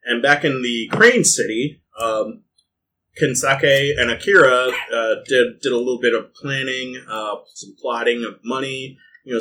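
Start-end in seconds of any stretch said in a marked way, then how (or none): none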